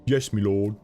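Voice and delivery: brief Northern English accent; Northern England accent. Shown as a demonstration. Showing the game character's speech, Northern England accent. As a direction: answer like a Northern English servant. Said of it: "a Northern England accent".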